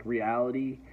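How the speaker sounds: ordinary talk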